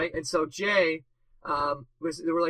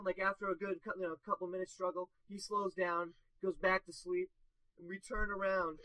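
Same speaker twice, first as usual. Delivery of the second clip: usual speaking voice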